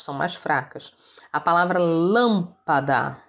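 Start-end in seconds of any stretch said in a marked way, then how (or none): 1.8-3.2 teacher demonstrating the strong start of "lâmpada"; stress on LÂM. Shown as a demonstration. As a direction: punch LÂM in "lâmpada", then let "pada" follow. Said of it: "é a sílaba mais forte".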